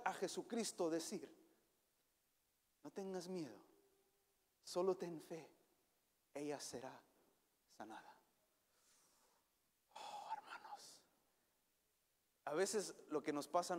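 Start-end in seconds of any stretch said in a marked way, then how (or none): 2.8-8.1 hushed and gentle, almost a murmur; tender, fading whisper-soft reassurance. Acted. Jesus's words to the grieving father. 9.9-11.0 breathy hushed whisper to the flock; a sudden whispered plea. Real, not acted. Preacher's intimate appeal to congregation.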